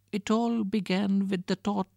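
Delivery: high little crocodile voice; small crocodile voice. Acted.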